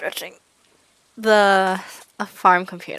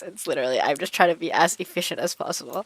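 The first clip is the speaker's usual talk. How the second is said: ordinary talk, nothing marked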